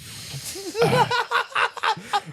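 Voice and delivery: high-pitched burst of laughter; laughing hard. Real, not acted. Cracking up at the co-host's remark, high laughter.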